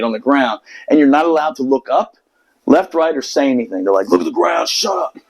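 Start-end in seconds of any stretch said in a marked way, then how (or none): 4.1-5.2 high, strained mock yell; mock barked order. Marines ordering seated recruits around, mock barked order. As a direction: imitate a drill order without full volume.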